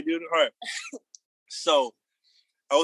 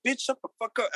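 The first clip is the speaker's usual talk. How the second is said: angry retort; 9 semitones higher, 4 dB quieter than usual. Retold or imitated. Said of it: "And I was like".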